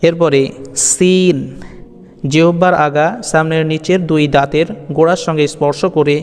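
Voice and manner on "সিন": সিন with a dental s and long ee; drawn-out letter name. Shown as a demonstration. Teacher introducing the next letter, stretched সিন. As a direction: hold the ee in সিন. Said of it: "একটু টেনে".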